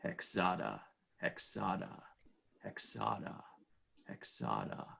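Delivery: in his own voice, no accent. Shown as a demonstration. Player voicing his character's summons on request, low murmur. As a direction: breathe Hexada low and quiet, over and over. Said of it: "Could you say Hexada in your voice?".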